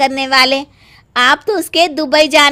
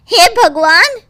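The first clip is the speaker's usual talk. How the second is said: shocked exclamation; 5 semitones higher, 5 dB louder than usual. Acted. Mother-in-law sees the con revealed.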